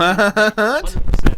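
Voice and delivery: slow, booming emphatic "hundred percent"; drawn-out loud insistence. Real, not acted. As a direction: stretch it out big and loud. Insisting London Bridge moved.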